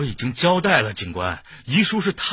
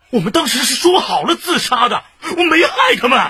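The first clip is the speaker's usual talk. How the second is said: high, loud protest of innocence; agitated shouting; 9 semitones higher, 5 dB louder than usual. Acted. Suspect insisting to police that he harmed nobody, shouting. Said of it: "你嚷嚷什么？".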